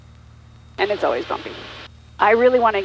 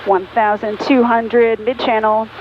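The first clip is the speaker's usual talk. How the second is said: radio call. Real, not acted. Pilot radio position call.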